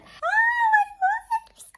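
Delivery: very high squeal; delighted squeal. Real, not acted. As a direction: squeal over your lips. Squeal at final look.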